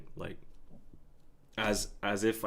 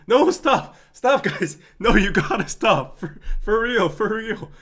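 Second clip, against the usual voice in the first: loud, high, flustered pleas to stop; overwhelmed streamer yelling at chat to stop; overwhelmed pleading; 7 semitones higher, 10 dB louder than usual. Real, not acted. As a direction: beg the chat to stop, half laughing. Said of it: "being dead serious".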